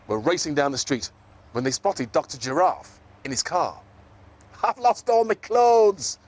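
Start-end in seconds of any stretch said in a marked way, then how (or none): 4.6-6.2 high, loud, slow wail; a little zebra's storybook despair; hammed-up wail over lost clothes. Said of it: "wailed Zippity".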